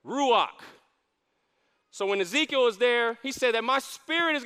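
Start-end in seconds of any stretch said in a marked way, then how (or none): 0.0-0.6 "Ruach" said as roo-AHK with a throaty k; Hebrew ruach. Shown as a demonstration. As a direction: say "Ruach" slowly in two syllables. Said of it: "The Hebrew word for breath is ruach".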